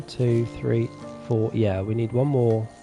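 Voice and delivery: normal talking voice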